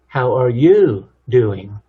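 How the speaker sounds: slow, with a loud, drawn-out "you"; stress on you. Shown as a demonstration. Showing another emphasis of one question, "you" stressed. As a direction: punch and stretch "you", then let "doing" drop. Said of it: "That's a little different emphasis".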